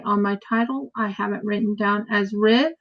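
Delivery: ordinary talk, nothing marked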